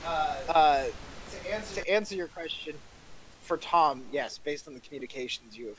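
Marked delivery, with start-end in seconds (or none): none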